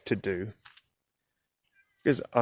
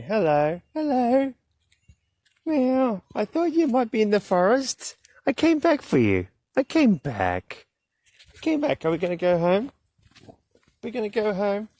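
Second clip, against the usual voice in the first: high pet talk; 11 semitones higher, 5 dB louder than usual. Real, not acted. Finding the cat again, talks to her in high baby talk.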